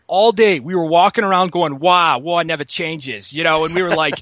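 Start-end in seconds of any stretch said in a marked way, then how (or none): 1.8-3.3 put-on slow, drawn-out Boston accent with the "r" dropped from "Wah"; "Wah" stretched the Boston way, as a joke; mock Boston accent. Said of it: "I want the accent so bad".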